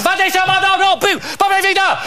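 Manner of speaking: put-on high, excited foreign accent yelling; squealing hotel worker spotting the rat; panicked hotel worker. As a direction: shriek in alarm at the rat.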